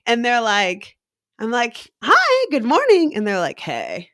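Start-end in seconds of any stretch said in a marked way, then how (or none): 2.0-3.1 high, loud chirpy barista hello; perky greeting. Acted. Barista greeting a laid-back customer, perky. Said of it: "I'm a perky as fuck". 3.6-4.1 low imitated chilled-out hey; laid-back stoner. Ganja-scented customer answering the barista, laid-back. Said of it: "they smell of the ganj".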